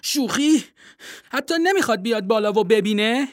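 outraged disbelief from the penguin; angry penguin. Acted. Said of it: "با عصبانیت توی آب دست و پا زد و گفت".

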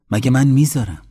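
won't let her go; emphatic refusal. Acted.

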